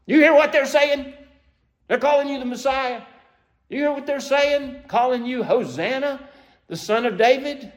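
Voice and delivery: high, pointed outrage at Jesus; indignant priests. Retold or imitated. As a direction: challenge him, incredulous and scandalized. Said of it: "they turn to Jesus Christ".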